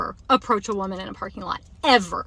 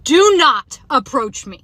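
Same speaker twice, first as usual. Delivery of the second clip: loud, higher, slow warning; retold yell; 4 semitones higher, 6 dB louder, slower than usual. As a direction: re-enact yelling a firm warning, drawing out the words. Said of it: "I literally yelled at him".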